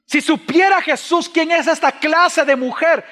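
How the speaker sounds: high, slow, loud scornful remark; the Pharisee sneering at the woman, high and loud. Acted. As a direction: look down on her, drawn out and high. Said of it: "el fariseo pensó y él dijo".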